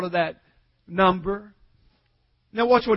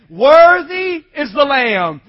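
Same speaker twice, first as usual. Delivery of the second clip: loud, slow, stretched-out proclamation; drawn-out pulpit cry; multitude's proclamation; 4 semitones higher, 10 dB louder, slower than usual. Shown as a demonstration. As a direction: proclaim it like a vast crowd in worship. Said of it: "Say it again".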